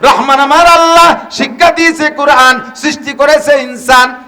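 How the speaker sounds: high, loud impassioned sermon shout; fiery preacher peak; preaching at full force. Acted. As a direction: cry it out to the crowd. Preacher cries out the translation.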